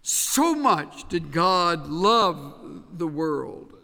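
So much did God love, slow, each word weighted; slow, weighty stress on So much; slow emphasis. Shown as a demonstration. Preacher dwelling on the phrase for emphasis, slow and weighty. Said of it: "but that's for emphasis' sake".

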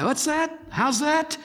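put-on high, puzzled old man asking again; hard-of-hearing old man. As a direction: mimic a deaf old man straining to hear.

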